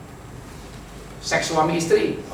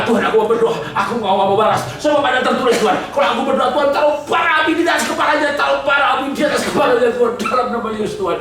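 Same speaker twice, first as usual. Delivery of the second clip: loud, high praying for coals on his head; mock fiery prayer, loud and high; fervent cursing prayer; 8 semitones higher, 4 dB louder, faster than usual. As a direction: pray loudly as if calling down fire. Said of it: "lalu berdoa".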